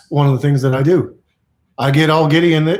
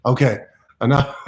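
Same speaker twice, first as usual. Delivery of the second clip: normal talking voice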